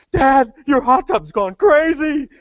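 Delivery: high, loud, slow daughter's plea; mock whiny teenage daughter; daughter's text. Daughter texting dad about the hot tub, high slow whine.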